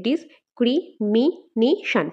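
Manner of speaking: "Discrimination" slowly in five detached beats, dis-cri-mi-na-tion; syllable by syllable. Shown as a demonstration. Teacher counting five syllables, "discrimination" said syllable by syllable. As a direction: leave a gap after each part of "discrimination". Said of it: "ভাঙলে আমরা পাঁচটা syllable পাই".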